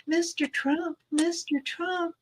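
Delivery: high, soft mock calling; lightly mimicked high-pitched appeal; imitating eager callers. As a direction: call out in a small high voice. Voices people approaching the speaker.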